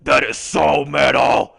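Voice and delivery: loud, slow put-on growly cheer; booming character aside; metal fan voice. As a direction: draw it out like a metalhead. Put-on voice cuts into panic.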